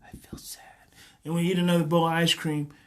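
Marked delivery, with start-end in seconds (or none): 0.0-0.6 faint inner voice; so quiet. Acted. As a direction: whisper it like a tiny knock. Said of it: "because it's so quiet".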